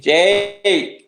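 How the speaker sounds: loud call of the name. Real, not acted. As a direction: shout the name down a bad line. Caller calls out to host.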